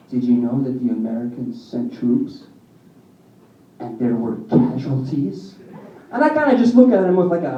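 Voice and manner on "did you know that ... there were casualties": intense. Retold or imitated. Cousin presses his conspiracy theory on a doubter, low and intense. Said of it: "looks at me really intensely and goes".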